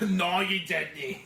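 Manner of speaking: put-on slow, drawn-out Scottish accent; lightly Scottish, stretched slowly; mock Scottish. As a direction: drag out the Scottish "No, you didn't". Repeating in Scottish voice.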